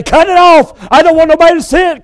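frantic high cry, cut it off; panicked, pleading shout; screaming to cut it off. Retold or imitated. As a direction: plead in panic to stop the screen. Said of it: "you'd scream out and say".

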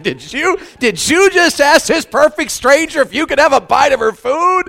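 high, incredulous mock-mortified friend; scandalized, high-pitched question; mortified disbelief. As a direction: imitate a buddy aghast with embarrassment. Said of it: "about died of embarrassment".

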